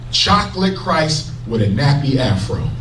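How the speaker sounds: normal talking voice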